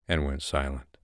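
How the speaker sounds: ordinary delivery, nothing marked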